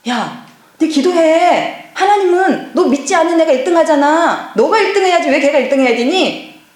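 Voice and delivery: a mother pushing her son to pray for first place; pushy mother scolding. Retold or imitated. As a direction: drag each word out, pressing the child hard.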